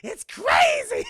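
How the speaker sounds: high, loud and slowly stretched; a hammed-up thrilled cry; gleeful mock shout. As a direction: savor it like a delighted villain. Boss gleeful at torture.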